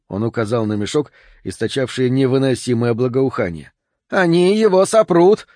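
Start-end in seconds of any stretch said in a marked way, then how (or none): 4.1-5.5 high, loud wheezy protest; сипло. A narrator's reading. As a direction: wheeze it out slowly, alarmed. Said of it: "просипел бродяга". (сипло, высоко, протяжно)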